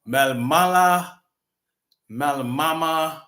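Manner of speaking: Malmala and Malmama said slowly, syllable by syllable; careful slow try at the name; unsure pronunciation. Real, not acted. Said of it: "that's the way you pronounce his name".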